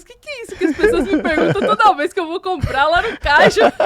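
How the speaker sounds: high, loud retelling through laughter; laughing exasperation. Retold or imitated. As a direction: laugh through a mock-desperate plea. Laughing retelling of her own confusion. (contando aos risos, com desespero cômico)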